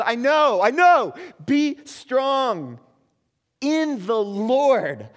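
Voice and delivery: loud, high, building to a shout; preacher voicing God at full shout; God's rising cry. God voiced, preaching climax.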